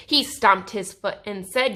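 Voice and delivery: usual speaking voice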